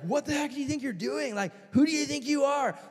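high, indignant mock yelling; mock angry kids. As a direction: play the kids telling you off. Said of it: "We started to get cussed out".

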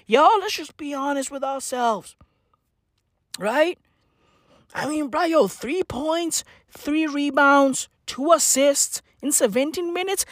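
usual speaking voice

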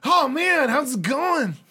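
high, loud, gushing hello; overexcited squeaky underdog; eager pledge voice. Acted. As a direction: greet an old pal far too eagerly. Dog Shit delighted to see friend.

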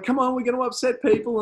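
high, loud hurried mock plea; urgent nudge. Fan urging Therese to move along, high urgent mimic. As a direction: hurry her along, half panicked.

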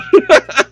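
high burst of laughter. Real, not acted. Laughs at a comment he can't read.

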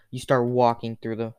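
ordinary talk, nothing marked

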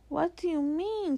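put-on high, alarmed child's question; crying out. A narrator's reading. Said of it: "cried Lucy".

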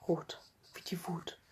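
an eerie faint whisper. Acted. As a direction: breathe it out eerily. Closing the red section. (unheimlich geflüstert)

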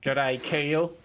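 playful Aussie greeting; put-on Australian accent; mock Australian accent. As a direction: greet a mate in Australian twang. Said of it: "Aussie, Aussie words".

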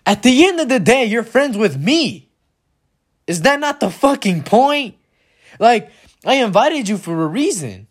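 high-pitched, indignant appeal; worked-up, emphatic protest; exasperated plea. Acted. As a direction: argue your case in rising disbelief. Imagined confrontation with invited friends.

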